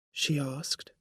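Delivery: ordinary delivery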